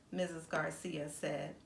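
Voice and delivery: plain reading voice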